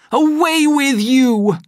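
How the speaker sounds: slow, loud, high order; furious command. A narrator's reading. Savage throwing Lenina off in fury. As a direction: drive her off. Pushes her away.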